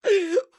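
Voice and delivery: quick loud, high laugh; short explosive laugh; real laugh. Real, not acted. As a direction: burst out in a high cackle. Cracks up at own joke.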